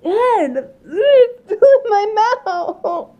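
slow, laughing near tears; laughing disgust. Real, not acted. Bean still in mouth.

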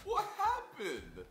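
high, slow squeal of disbelief; shocked squeal. Real, not acted. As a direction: stretch it out, aghast. Repeats the shock.

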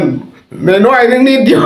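laughing, drawn out. Retold or imitated. Host repeating his joke answer to listeners, helpless laughter. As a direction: stretch it out while cracking up.

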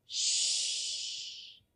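a long whispered shh; soft shushing. Shown as a demonstration. Quieting the children so the baby sleeps, soft shush. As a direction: hush them with a breathy shh. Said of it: "Hagamos silencio".

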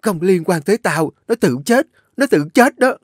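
panicked denial. A narrator's reading. An Nhã denies the death, frantic panic. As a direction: repeat it in mounting panic. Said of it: "miệng không ngừng lẩm bẩm".

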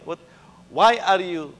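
ordinary talk

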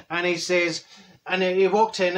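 ordinary talk

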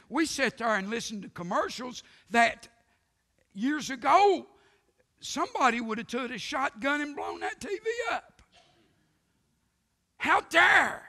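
rising outrage peaking on How dare; preaching at full force. Real, not acted. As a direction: climb to a pulpit roar. Preacher's mounting indignation.

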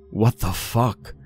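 high, slow shocked outcry; shocked disbelieving cry; horrified scream. A narrator's reading. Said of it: "I screamed".

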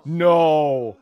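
loud shout of no. Real, not acted.

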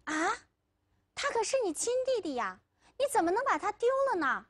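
high, rushed astonished protest; astonished and reproachful, quick and high; shocked. A narrator's reading. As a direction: gasp it out in disbelief, pitch jumping up. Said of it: "惊奇地张大了嘴巴".

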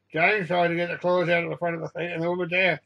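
plain reading voice

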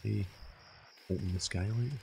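ordinary delivery

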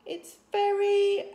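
high, loud, slow halting chief voice; awkward chief. A narrator's reading. Father hunts for words about the tiny dragon: high halting. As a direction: stumble for a kind word.

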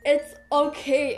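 normal talking voice

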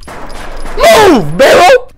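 high, very loud yell; panicked shout. Real, not acted. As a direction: scream it at what blocks you. Panicked at obstacle in game.

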